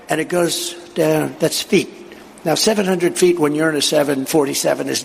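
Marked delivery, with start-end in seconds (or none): none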